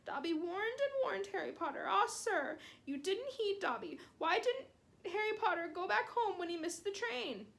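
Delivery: high, squeaky elf voice, begging; a small elf scolding his hero; pleading house elf. Acted. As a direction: house elf, high and anxious, reproaching Harry.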